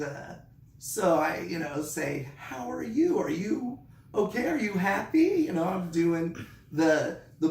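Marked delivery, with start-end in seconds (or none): 2.4-5.4 bright upbeat check-in, pitched well up; high, eager kindergarten-teacher questions; high coaxing teacher voice. Retold or imitated. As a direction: coax a sulking kid with eager questions. Coaxing sullen child, high.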